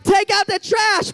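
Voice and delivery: loud, high barked chore order; parent's order. Retold or imitated. Parent interrupting something important, loud high order.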